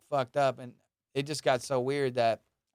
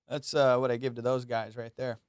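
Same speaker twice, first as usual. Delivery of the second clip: ordinary talk, nothing marked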